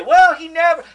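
lightly acted denial, jumping high and loud; mock flustered spokeswoman. Press secretary rushing in to cover for the president, mock protest. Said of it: "she has to run in and say".

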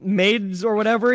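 usual speaking voice

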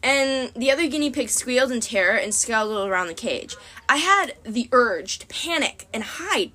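ordinary delivery

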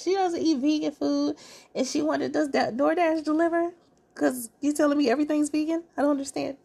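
high, put-on voice mocking her confusion; mock Arena voice. Recapping the dinner party, mimicking Arena in a high mock voice. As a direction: play her baffled and a bit entitled.

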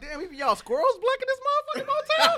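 high-pitched, laughing mock shock at the squirrels; squealing with laughter in disbelief. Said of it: "I was like".

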